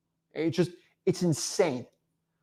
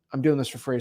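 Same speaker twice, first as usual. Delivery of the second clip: normal talking voice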